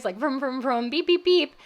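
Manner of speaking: playful vroom and beep noises, rising to a loud high final beep; car noises. Retold or imitated. Laughter yoga game, imitating car sounds, loud and playful. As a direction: make toy car sounds like a kid driving. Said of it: "we had to make all these noises".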